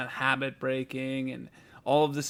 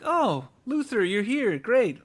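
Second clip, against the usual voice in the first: higher, drawn-out "Oh", mock-cheery clerk; over-friendly greeting; 7 semitones higher, 3 dB louder, slower than usual. Store staff greet Luther at pickup, over-friendly.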